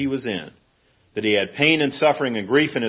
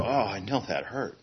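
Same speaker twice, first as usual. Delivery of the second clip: soft, low soothing murmur; tender comfort; 4 semitones lower, 11 dB quieter than usual. Retold or imitated. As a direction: comfort a crying child gently. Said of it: "and you go".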